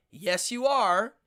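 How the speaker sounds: loud, slow, drawn-out retort; firm insistence. Retold or imitated. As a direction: imitate a passenger who won't budge. Passenger insisting to cabbie, acted out.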